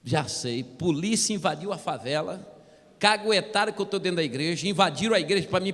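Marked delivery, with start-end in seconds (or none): none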